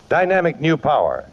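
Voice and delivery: usual speaking voice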